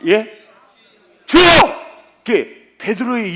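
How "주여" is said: high loud cry of Lord. Retold or imitated. 고난을 말리는 베드로가 주를 부르는 높은 외침. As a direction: call out to the Lord at full voice.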